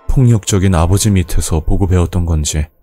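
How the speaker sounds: ordinary delivery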